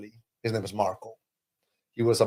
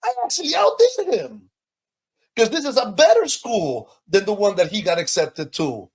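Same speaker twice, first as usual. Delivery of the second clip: proud triumph; 10 semitones higher, 4 dB louder than usual. Retold or imitated. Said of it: "And I was like".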